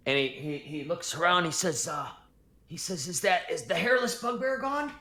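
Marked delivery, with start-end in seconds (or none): 3.1-4.9 high, timid goblin stammering in fear; frightened high goblin pleading. Acted. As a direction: squeak it out like a scared little goblin. Goblin asks if half-orc left.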